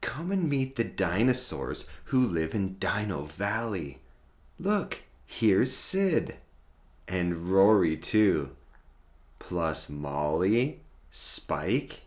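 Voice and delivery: ordinary delivery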